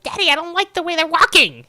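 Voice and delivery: put-on high, loud whiny daddy's girl voice; mock a spoiled brat whining to daddy; whiny spoiled child. Spoiled customer whining to her daddy about passersby, high loud whine. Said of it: "she'd be like".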